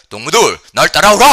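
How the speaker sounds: loud, rising North Korean rallying call; North Korean accent. Shown as a demonstration. Preacher shows the congregation scripture in North Korean speech, rousing North Korean accent. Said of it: "한번 북한 말로 해볼까요?".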